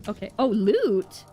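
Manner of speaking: normal talking voice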